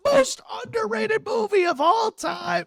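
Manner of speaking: slow, high, loud proclamation; hyped shout. Pretend. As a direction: belt it out like a movie trailer. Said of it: "But just so I could be like".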